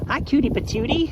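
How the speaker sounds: high, loud cooing greeting. Real, not acted. Farmer greeting her goat in high baby talk. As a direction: coo it up at a cute goat.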